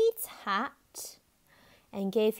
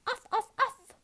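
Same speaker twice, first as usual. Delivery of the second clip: high, insistent little barks; yapping dog; 12 semitones higher than usual. A narrator's reading. Tiny the dog refuses to go, yapping barks. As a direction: yap like a small defiant dog. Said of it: "added Tiny".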